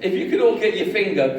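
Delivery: normal talking voice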